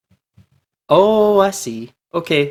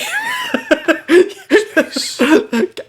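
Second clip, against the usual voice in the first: high, falling burst of laughter. Real, not acted. Delighted by the game, a high falling laugh. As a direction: crack up high.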